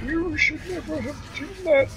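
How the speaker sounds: space aristocrat villain. Acted. Mocking a film's evil space aristocrat, hushed high voice. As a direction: impersonate a villain murmuring in a strange high pitch. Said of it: "it talks like this".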